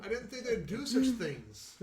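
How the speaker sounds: high character voice with a laugh in it; playful high-voiced defence; high-pitched sheepish excuse. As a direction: protest innocence, half laughing. She excuses herself, laughing.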